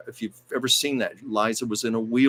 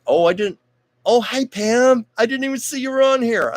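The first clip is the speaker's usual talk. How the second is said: much higher, bright sudden greeting; delighted surprise; 14 semitones higher, 6 dB louder than usual. Real, not acted. Host spotting viewer Pam in the chat, surprised and much higher. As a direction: pleasantly caught off guard by a viewer.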